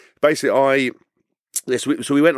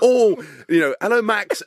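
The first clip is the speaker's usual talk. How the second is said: mock doting dog owner; 10 semitones higher than usual. Lads pretending dogs are theirs.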